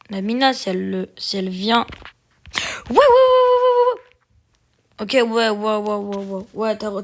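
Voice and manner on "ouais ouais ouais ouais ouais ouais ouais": high, loud rapid yeahs; excited squeal. Real, not acted. Excited high yes run.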